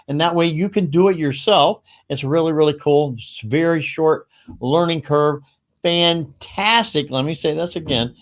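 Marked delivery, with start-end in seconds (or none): 5.8-7.1 FANTASTIC drawn out very slowly; emphatic praise. Real, not acted. Host praising the software's support, emphatic and drawn out.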